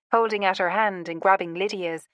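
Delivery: ordinary delivery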